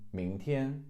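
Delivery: 明天 joined, rising then high level; teacher recaps 明天; whole word. Shown as a demonstration. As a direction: say 明天 smoothly in one go. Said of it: "Second tone, first tone".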